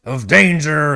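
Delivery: high, loud indignant sputter; blubbering outrage. Acted. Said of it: "he starts blubbering again".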